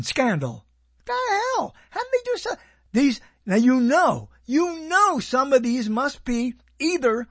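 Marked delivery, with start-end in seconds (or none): 1.1-2.5 very high-pitched incredulous outburst; baffled disbelief. Real, not acted. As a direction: squeak it out in disbelief. Baffled by a seventh season.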